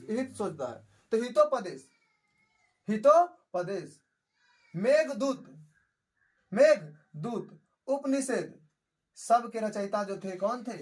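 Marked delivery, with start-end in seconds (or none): 2.9-4.0 Hitopadesha slowly, split as हितो, then पदेश; slow dictation. Shown as a demonstration. Teacher repeating a book title for students, Hitopadesha in slow, separated parts. 6.5-8.5 Meghdoot slowly, split as मेघ, then दूत; slow dictation. Shown as a demonstration. Teacher repeating a book title for students, Meghdoot in slow, separated parts.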